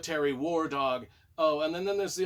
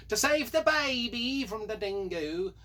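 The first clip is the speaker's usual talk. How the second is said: higher, slow, drawn-out Aussie outcry; mock Australian; 6 semitones higher, 4 dB louder than usual. Reviewer turning the plot into a dingo gag, mock Australian. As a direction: ham up an Australian accent.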